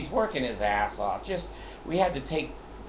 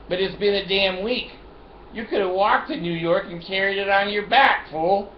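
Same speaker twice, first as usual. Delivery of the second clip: loud, high, heated telling-off; scolding the bed company owner, loud and angry; 7 semitones higher, 4 dB louder than usual. Retold or imitated. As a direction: lay into him, raised voice. Angry rant at delivery company.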